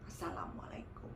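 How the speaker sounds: whispered, barely voiced salam; soft whispered retold salam; hushed greeting. Child quietly greeting at door.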